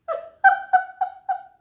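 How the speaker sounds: shrieking with laughter at the shirt; high loud laugh shriek. Real, not acted. Shirt reveal.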